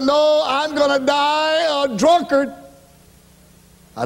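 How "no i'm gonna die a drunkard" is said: put-on defiant drunk. Drunk husband answering wife.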